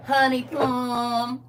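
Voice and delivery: slow, drawn-out Southern drawl; Southern endearment. Retold or imitated. Quoting Southern pet names, slow country drawl. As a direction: stretch out the pet name in a Southern accent. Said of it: "my brother sounds like that".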